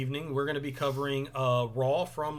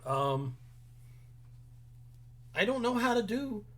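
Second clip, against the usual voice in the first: ordinary talk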